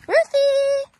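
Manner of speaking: high, loud call across the field. Real, not acted. Calling Ruthie the cow over, loud and high. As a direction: call her name to bring her over.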